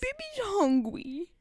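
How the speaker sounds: put-on high, slow babyish whine; baby talk. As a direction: whimper like a toddler wanting food. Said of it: "baby words".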